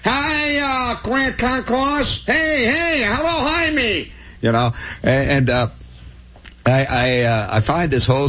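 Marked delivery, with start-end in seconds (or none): 0.0-4.1 high, slow hammed-up hollered hello; mock holler. Imported model mockingly hollers hello from the pool, high and slow. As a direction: ham it up like a hired model. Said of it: "jump up and holler".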